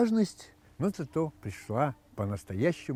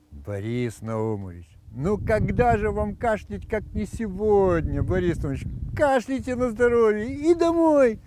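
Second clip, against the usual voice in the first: slow, high, loud doctor; Odessa doctor punchline; 7 semitones higher, 4 dB louder than usual. Acted.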